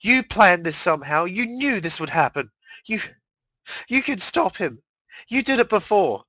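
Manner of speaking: high, loud, pleading rage; desperate accusation. Acted. Mare begging and accusing her sister, loud and high.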